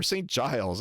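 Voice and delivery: Saint Giles as "saint jiles"; anglicized Saint Giles, rhyming with miles; fully English pronunciation. Shown as a demonstration. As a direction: say Saint Giles all in English, hard J sound. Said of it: "Just do it English".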